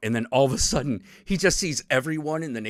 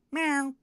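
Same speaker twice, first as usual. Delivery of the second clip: high cat meow, softly; imitated meow; 13 semitones higher, 4 dB quieter than usual. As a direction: mew like the floating shop cat. Floating cat greets player.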